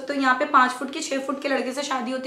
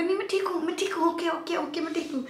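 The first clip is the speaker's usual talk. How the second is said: nervous fast reassurance; 5 semitones higher, 4 dB quieter than usual. Retold or imitated. Scared girl refusing the seat.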